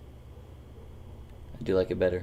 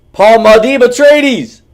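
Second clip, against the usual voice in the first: long, booming high announcer cry; dramatic movie shout; 17 dB louder, slower than usual. Acted. Streamer hyping a movie to chat, booming trailer shout. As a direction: belt the name like a trailer voice. Hypes a movie name, drawn out.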